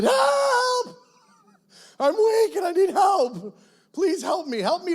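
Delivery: high, loud, strained crying plea that softens toward the end; desperate cry for help. Retold or imitated. Gideon crying out to God in his weakness, a high, loud, desperate plea.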